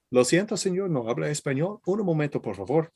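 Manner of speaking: Spanish phrase. Retold or imitated. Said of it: "You have a very good ear for accents".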